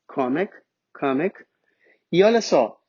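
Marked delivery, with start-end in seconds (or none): 0.1-1.4 kamek as "KAH-mik", low and deliberate; English pronunciation. Shown as a demonstration. Teacher modelling two syllables, kamek pronounced in English. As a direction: say kamek twice as English "comic". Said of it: "duas sílabas".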